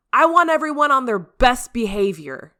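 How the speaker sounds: loud, high warning from the boss. Acted. Captain warning the team before the party, loud warning. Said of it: "a warning tone in his voice".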